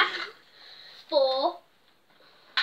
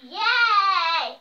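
excited; 3 semitones higher, 8 dB louder than usual. Real, not acted. Opening advent calendar door.

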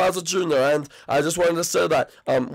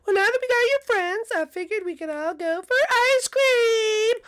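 mom voice. Acted. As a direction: speak as a gentle mom to her son. Mom suggests ice cream.

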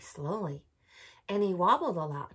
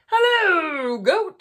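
cheerful duck greeting; 11 semitones higher, 13 dB louder, much slower than usual. Acted. Duck greets goat in story.

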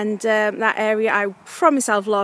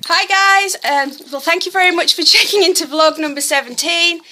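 excited greeting; 7 semitones higher, 10 dB louder than usual. Real, not acted. Bright vlog intro after walk.

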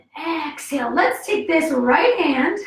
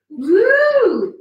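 loud, raised "woo"; excited whoop; 6 semitones higher, 8 dB louder than usual. Real, not acted. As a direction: let out a long whoop. Exclaims before a harder pose.

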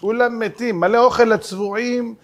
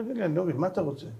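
soft puzzled question; 10 dB quieter, much faster than usual. Retold or imitated. Rabbi calmly puzzled.

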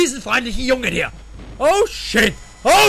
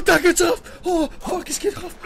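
normal talking voice

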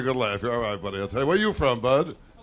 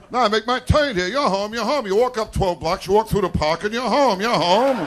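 mock cheery friends, pitched up and louder; hammed-up pals talking him out of the car; friends dumping him; 8 semitones higher, 7 dB louder than usual. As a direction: friends pretending he's nearly home, brightly loud. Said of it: "They always have to make a turn".

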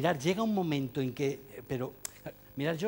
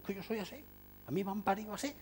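a pigheaded teacher, a bit higher and softer, insisting; stubborn mock quote; 5 semitones higher, 7 dB quieter, faster than usual. Teacher imitating stubborn colleagues, mock defiant. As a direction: dig in as if nobody could ever change you. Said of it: "Esta especie de cerrilismo".

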